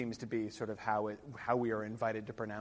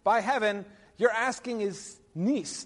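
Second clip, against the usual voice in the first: high, loud, drawn-out reading; declaimed verse line; 10 semitones higher, 7 dB louder than usual. Acted. Lecturer glossing Arthur's response, declaimed.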